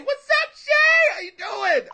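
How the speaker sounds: high, loud shouted greeting across the room; calling out. Real, not acted. Greeting his little sister with a loud shout.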